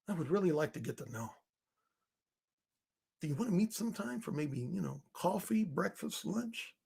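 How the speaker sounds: soft, hesitant mock of a clueless guy asking a woman out; mocking timid asker. Mocking a clueless man asking a woman for coffee, soft timid voice. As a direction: mumble it like a nervous nerd asking for a date. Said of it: "who the fuck talks like this".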